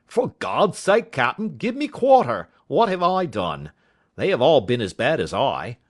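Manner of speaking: high, loud desperate plea; pleading cry. A narrator's reading. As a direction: cry out begging for mercy. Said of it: "Will Atkins cried out".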